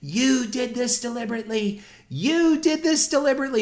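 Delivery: very high, accusing shrieked voice; a hint of an outraged old lady; old lady screaming. Angry old lady accusing the manager to his face, high shriek. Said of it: "This woman was screaming".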